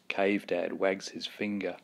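usual speaking voice

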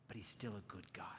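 hushed, firm whisper; whispered conviction. Real, not acted. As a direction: whisper it as a deep truth. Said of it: "whispered conviction".

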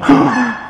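sharp pretend gasp; shocked gasp. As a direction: gasp in mock shock at the sight. Pretend shock at the uncovered eye. (şaşkınlıkla nefesini içine çekme)

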